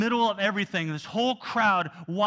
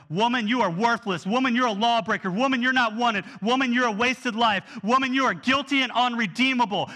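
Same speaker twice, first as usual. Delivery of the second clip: loud, higher, hammered accusations; a raised voice of scorn; harsh condemning voice; 6 semitones higher, 6 dB louder than usual. Acted. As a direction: throw each word at her like a stone. Harsh condemnation Jesus did not give.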